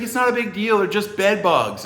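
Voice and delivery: high-pitched mock-breezy voice; dismissive mimicry. Lady to departing visitor about bugs, dismissive. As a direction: imitate her playing it down. Acting out her dismissive reply.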